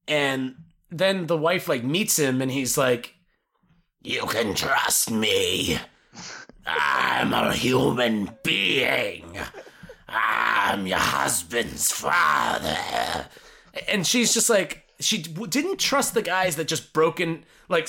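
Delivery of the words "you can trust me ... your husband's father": put-on much lower, slow old man growl; Nick Nolte impression. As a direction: drag it out low and gravel-free. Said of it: "love impersonating his voice".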